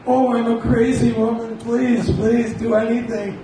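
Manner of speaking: high, loud, desperate begging; frantic pleading. Retold or imitated. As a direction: plead wildly like an overwhelmed mother-in-law. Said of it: "crying and screaming".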